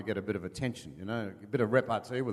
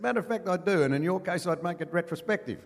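a raised, snappy retort to the heckler; quick comeback; 7 semitones higher than usual. Retold or imitated. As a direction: fire the punchline back, pleased with yourself. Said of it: "Artie shot back".

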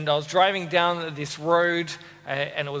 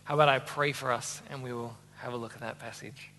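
ordinary delivery

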